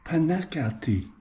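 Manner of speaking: Panakati said slowly, syllable by syllable; careful tentative Panakati; trying the name. Shown as a demonstration. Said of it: "Is that how you say it?".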